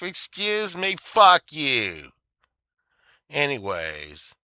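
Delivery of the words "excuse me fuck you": fed-up curse. Real, not acted. Angry at courthouse runaround.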